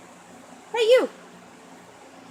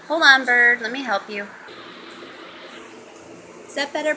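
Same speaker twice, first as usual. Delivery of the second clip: ordinary delivery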